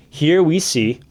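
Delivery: about to cough, throat tickling. Real, not acted. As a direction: voice catching on a cough. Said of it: "man, I'm coughing".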